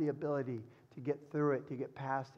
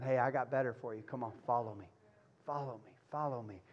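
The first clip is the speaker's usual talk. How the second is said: softer and lower, coaxing follow me; gentle invitation; 4 semitones lower, 4 dB quieter than usual. Retold or imitated. Shepherd Jesus softly inviting the listener to follow. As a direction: call them on tenderly.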